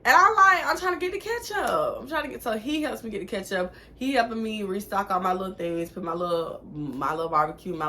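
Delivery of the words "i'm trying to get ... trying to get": high, flustered explaining. Retold or imitated. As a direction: sound caught climbing shelves. Retold flustered answer.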